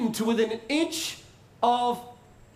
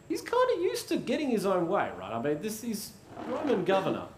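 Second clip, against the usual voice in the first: ordinary talk, nothing marked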